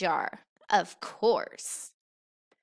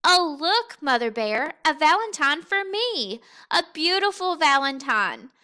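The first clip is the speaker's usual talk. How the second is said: higher and louder, thrilled cub; bright-eyed childlike delight, higher and louder; excited; 5 semitones higher, 6 dB louder than usual. A narrator's reading. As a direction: gush over the valentine like an eager child. Little Bear excited over card.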